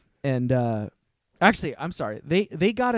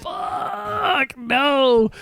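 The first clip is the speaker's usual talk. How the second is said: very high, loud yelp of panic; panicked cry; 12 semitones higher, 4 dB louder than usual. Retold or imitated. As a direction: squeal it as the cops roll up. Cops arrive, panic.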